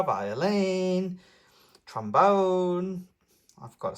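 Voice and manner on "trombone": usual speaking voice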